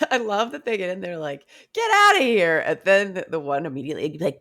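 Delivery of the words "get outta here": high-pitched hammed-up order; mock angry screech. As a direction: screech them out of the room. Acted order from brawling prostitutes.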